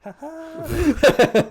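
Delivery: very high, loud taunting laugh; mocking laugh. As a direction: laugh at him as you fly off. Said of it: "he's like".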